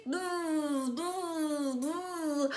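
low, soft droning buzz; bee buzz. Pretend. Storyteller running back through the bees, low soft buzzing. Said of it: "Back through the bees".

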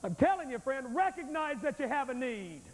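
usual speaking voice

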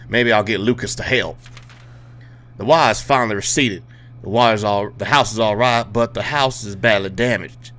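hammed-up Louisiana bayou accent; put-on Southern accent. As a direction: read the note in a mock Southern accent. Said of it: "I don't know why I'm talking with this accent".

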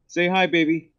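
usual speaking voice

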